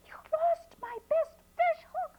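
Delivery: high, strained cry of dismay, stretched on "fish hook"; shocked high cry. Acted. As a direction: wail it out like a brother robbed of his prize hook. Brother lost his fish hook.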